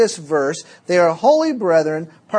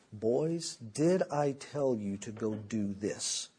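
low, quiet, slow interrogation; stern father; 8 semitones lower, 12 dB quieter, slower than usual. Retold or imitated. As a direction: question the boys with quiet menace. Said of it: "he'd look at me and he'd say".

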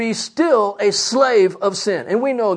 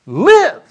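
"Live" called out higher and louder; stressed word; 6 semitones higher, 6 dB louder than usual. Shown as a demonstration. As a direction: hit "Live" hard as the answer. Preacher completes verse answering what?.

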